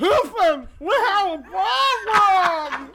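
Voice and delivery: shouting with a pickle in mouth; mouth full. Shown as a demonstration. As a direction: yell it around a mouthful. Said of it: "Now try to say".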